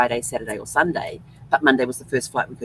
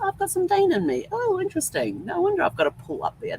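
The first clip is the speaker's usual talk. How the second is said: much higher, playful inner voice of discovery; mock surprise. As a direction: act out delighted surprise at the DNA result. Retold reaction to DNA result.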